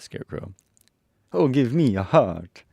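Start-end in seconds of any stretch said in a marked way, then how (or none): 1.3-2.6 high, loud, slow tin man; Tin Woodman. A narrator's reading. Tin Woodman wishing for a heart, high character voice. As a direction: raise and stretch the character voice. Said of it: "said the Tin Woodman".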